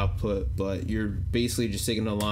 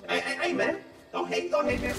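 chipmunk voice. Shown as a demonstration. High voice changer while gaming.

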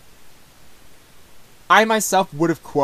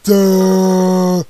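a mock dopey "duh", louder; imitating a dumb duh; 3 semitones higher, 7 dB louder than usual. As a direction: toss out a goofy dimwit "duh". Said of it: "more so like a duh kinda sound".

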